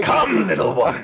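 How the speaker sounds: high mock-revival call; preacher. Host showing the correct voice for the game line, as a preacher. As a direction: summon the flock like a preacher. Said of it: "do that in the correct voice".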